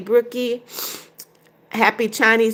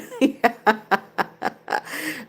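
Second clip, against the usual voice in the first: laugh; 4 dB quieter than usual. Real, not acted. Reading a funny viewer comment.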